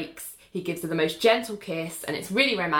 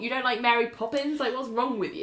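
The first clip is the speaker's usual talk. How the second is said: mildly indignant retold disbelief, pitched up; mock-baffled, challenging someone; incredulous; 5 semitones higher, faster than usual. Retelling Renee's baffled challenge to Ali.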